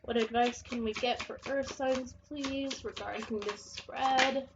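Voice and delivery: soft, measured request to the cards; card-reading invocation. Real, not acted. Tarot reader asking the cards for Earth signs' advice, soft invocation. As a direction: ask softly, drawing out the last words. Asks the cards while shuffling.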